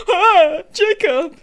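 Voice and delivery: high, loud mock-thrilled shout; excited cry. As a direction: gasp and squeal the name in delighted surprise. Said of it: "She's just excited to see him".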